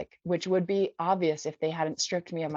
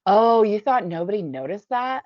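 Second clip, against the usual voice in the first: loud, higher mocking challenge; scornful accusation; 4 semitones higher, 8 dB louder than usual. Another girl confronts the newcomer, scornful. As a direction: call her out, loud and scornful.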